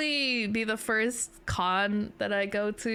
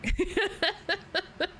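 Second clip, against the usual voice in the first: high giggle at own joke; 9 semitones higher than usual. Real, not acted. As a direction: laugh at your own coping. Laughs about coping.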